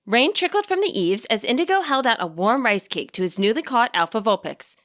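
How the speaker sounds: plain reading voice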